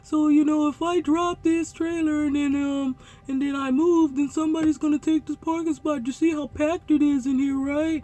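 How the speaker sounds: high mock voice for the other driver; put-on driver voice. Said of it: "using that voice for his voice".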